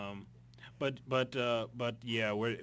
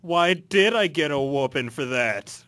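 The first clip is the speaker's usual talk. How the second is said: loud; 5 semitones higher, 13 dB louder than usual. Real, not acted. Mock outrage at mother.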